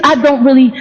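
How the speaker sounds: ordinary talk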